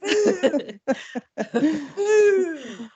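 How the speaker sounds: playful shouted cannon boom. Pretend. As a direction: fire off the cannonball with a big boom. Imitating cannonball in pirate game.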